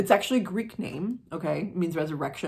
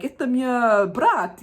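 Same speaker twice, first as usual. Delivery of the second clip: slow, high, loud broken Russian; mock Russian, pitched up and drawn out; bad Russian; 8 semitones higher, 7 dB louder, slower than usual. Woman waking from coma, in bad Russian. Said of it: "that was bad Russian".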